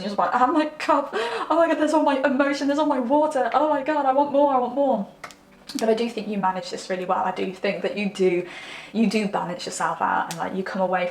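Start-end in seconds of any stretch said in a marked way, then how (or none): 0.3-5.1 higher, louder, fast mock gush of emotion; hammed-up gushing. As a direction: overflow in a playful rush. Acting out overflowing emotion.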